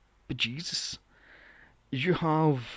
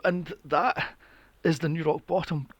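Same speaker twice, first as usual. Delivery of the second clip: ordinary talk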